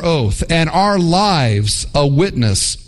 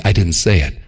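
smug low reassurance; 9 semitones lower than usual. Retold or imitated. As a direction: say it low and self-satisfied.

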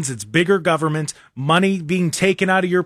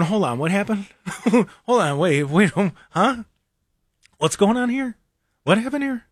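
half-hearted baffled hold on; puzzled asking what happened; mild mock confusion; 3 semitones higher than usual. Host recalling his disillusion with Obama, mock bafflement. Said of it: "I'm going".

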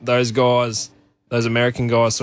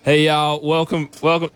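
higher-pitched attempt at an American accent; put-on American twang, pitched up; 4 semitones higher than usual. Shown as a demonstration. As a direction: try a Southern American welcome. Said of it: "give me that American accent one more time".

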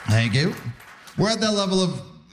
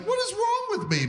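higher, pitched-up mock despair at his own habit; bewildered at himself; 4 dB quieter than usual. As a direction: squeak it out like you can't believe yourself. Self-mocking quote of himself.

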